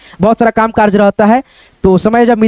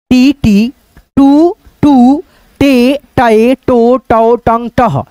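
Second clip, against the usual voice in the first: drawn-out, sing-song recital of each syllable; syllable drill; much slower than usual. Shown as a demonstration. Teacher reciting the ज syllable chart to students, sing-song. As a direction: chant the barakhadi one by one for pupils. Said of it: "सारे अक्षरों पे बैठाते जाएंगे".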